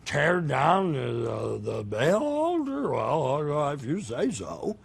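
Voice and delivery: mumbly put-on voice of hesitant misgiving; hammed-up reluctant agreement, stretched out; reluctant Gideon. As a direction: stall and waver, then give in. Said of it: "Gideon was like".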